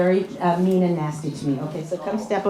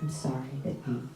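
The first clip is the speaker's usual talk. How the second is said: much softer, lower, calm apology; 9 semitones lower, 12 dB quieter than usual. Shown as a demonstration. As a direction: defuse it gently and quietly. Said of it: "Okay, good".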